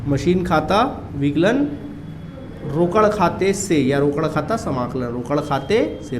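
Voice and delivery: usual speaking voice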